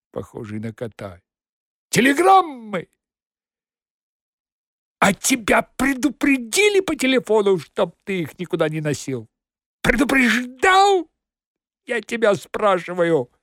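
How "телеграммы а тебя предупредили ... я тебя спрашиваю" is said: angry shouting. Acted. Cat interrogating the beaten man.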